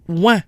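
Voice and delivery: "Ouin" glide into a nasal vowel; French nasal "Ouin". Shown as a demonstration. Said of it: "O I N gives us ouin".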